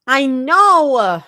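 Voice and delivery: high, loud snap; snapping. A narrator's reading. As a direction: snap back impatiently. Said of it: "Zoe snaps".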